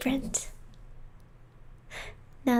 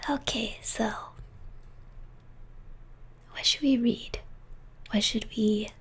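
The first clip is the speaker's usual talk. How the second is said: whispering; 6 dB quieter than usual. Real, not acted. Whispering ASMR stream.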